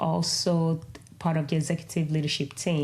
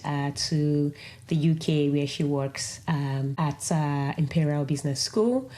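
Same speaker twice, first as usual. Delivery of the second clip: ordinary talk, nothing marked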